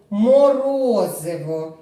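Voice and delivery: «Морозиво» slow and loud, vowels stretched; drawn-out word. Shown as a demonstration. Teacher models syllables. (голосно й протяжно)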